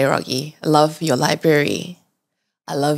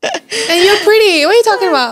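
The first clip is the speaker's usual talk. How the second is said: laughing through tears; 12 semitones higher, 5 dB louder than usual. Real, not acted. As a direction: protest through teary laughter. Host moved, insists guest is pretty.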